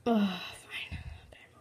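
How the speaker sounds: soft whispered give-in; a whispered oh fine; resigned whisper. Real, not acted. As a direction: breathe it out, defeated. Resigned aside.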